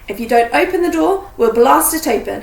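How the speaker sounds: loud threat through a door; shouted threat. A narrator's reading. Harry threatening to break in, loud and forceful. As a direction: call out an ultimatum.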